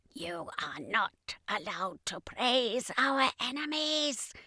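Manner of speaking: slow whispered alien scolding; angry alien thought. Acted. Second in command scolding the commander, whispered alien thought. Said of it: "or rather thought, angrily".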